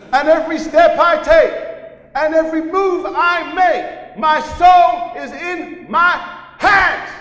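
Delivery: high shouted American preaching, slowing to the end; American preacher accent. Retold or imitated. American preacher shouting to the congregation, American accent. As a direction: draw it out like a fiery American preacher. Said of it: "not a bad American accent".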